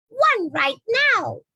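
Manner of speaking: high, slow squeaky bat voice. Acted. The bat squeaking at the girl, high and slow. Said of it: "the bat squeaked".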